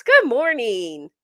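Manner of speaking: high, drawn-out sing-song greeting; hammed-up slow, chirpy good morning; mock bundle of joy. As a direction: stretch it out with fake cheer. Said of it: "thinks that I am just a bundle of joy".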